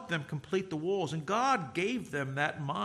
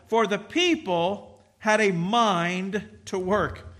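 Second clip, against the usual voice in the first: For the people had a mind to work, drawn out slowly; emphatic slow quote; 3 semitones higher, 4 dB louder, much slower than usual. Shown as a demonstration. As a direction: linger on every word of the verse. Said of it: "I want you to notice this last phrase".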